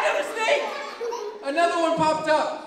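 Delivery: very high, loud squeal; squealed alarm; mock panic. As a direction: cry out in comic horror at the sight. Screamed at yet another snake.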